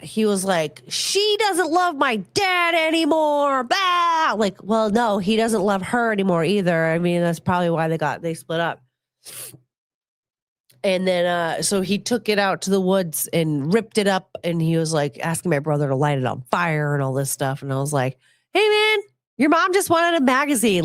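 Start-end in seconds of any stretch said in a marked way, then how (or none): 0.9-4.3 high, slow mock wail; mock upset boy. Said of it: "he was so upset". 18.5-20.8 high-pitched retold protest; mock protest. Retells pleading with upset neighbor boy.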